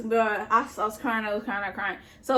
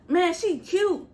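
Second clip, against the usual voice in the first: catcall; 7 semitones higher, slower than usual. Retold or imitated. Men calling from other cells.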